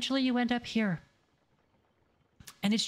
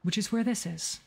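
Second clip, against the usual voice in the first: higher practice voice. Shown as a demonstration. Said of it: "you go to A three".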